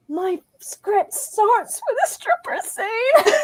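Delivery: high, slow, squeaky mock dismay; small, pained confession breaking into laughter; mortified aside. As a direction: squeak it out as if cringing to a friend. Mortified aside to friend, retold.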